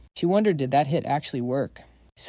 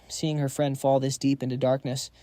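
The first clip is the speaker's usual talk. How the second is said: ordinary delivery, nothing marked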